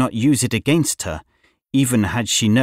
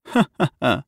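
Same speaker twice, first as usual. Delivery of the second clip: acted witch's ha-ha; spoken-out taunting laugh; mocking laugh; 2 semitones higher than usual. A narrator's reading. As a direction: laugh at her in mockery. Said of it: "laughing at her".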